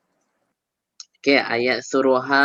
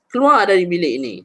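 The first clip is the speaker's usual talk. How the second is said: fast, loud, higher curt order; hardest command; 6 semitones higher, 7 dB louder, much faster than usual. Shown as a demonstration. Teacher showing the harshest bare command, sharply. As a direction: bark it out quickly. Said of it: "Ha, lagi keraskan ayat dia".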